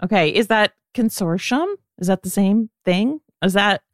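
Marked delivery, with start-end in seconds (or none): 0.9-1.7 "consortium" with a SH, con-SOR-shum; sh pronunciation. Shown as a demonstration. Co-host checks how the name is said, asking "consortium" as con-SOR-shum. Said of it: "how you pronounce that".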